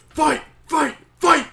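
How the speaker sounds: high, loud mock chant; egging on. Amethyst to the two fighters, egging them on. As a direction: cheer on a brawl in punchy bursts.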